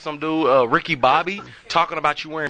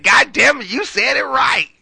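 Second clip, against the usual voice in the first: much higher and louder, delighted shout; friend's impressed outburst; excited exclamation; 12 semitones higher, 7 dB louder, slower than usual. Retold or imitated. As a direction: blurt it out amazed. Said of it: "And he said".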